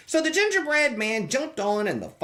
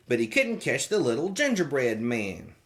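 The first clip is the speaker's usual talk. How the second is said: plain reading voice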